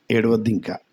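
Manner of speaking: soft comfort. Acted. God comforting the listener.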